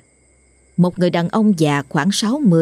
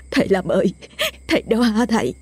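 tearful call, rising higher; sobbing plea; 5 semitones higher than usual. Acted. The wife greets the exorcist, sobbing and relieved. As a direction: cry out to him through sobs, voice climbing. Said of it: "khóc nấc với thầy".

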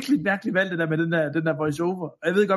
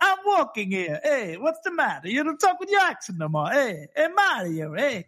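hammed-up, higher-pitched Italian-American wise guy; Italian-American accent; 6 semitones higher than usual. Mocking the film's voice-over to fellow hosts, hammed-up Italian-American accent. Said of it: "Den der italienske accent der".

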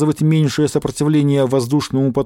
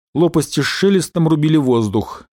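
ordinary delivery, nothing marked

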